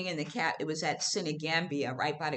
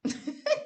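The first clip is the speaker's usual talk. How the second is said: high bubbling giggle; high laugh. Real, not acted. Reacting to Dwight's compliment, high giggle. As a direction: burst into a high flattered laugh. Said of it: "Dwight up here with the compliments".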